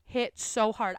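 ordinary talk

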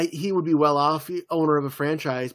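ordinary talk

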